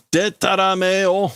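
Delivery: scornful exclamation. Acted. Reader voicing a character calling out nonsense, scornful. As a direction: act out an indignant dismissal.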